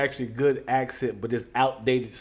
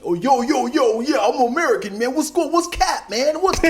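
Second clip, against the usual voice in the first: loud, high, hammed-up American slang; mock American accent; 10 semitones higher, 6 dB louder than usual. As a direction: play a Russian badly faking American. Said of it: "Can you talk American?".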